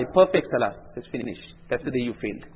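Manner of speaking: normal talking voice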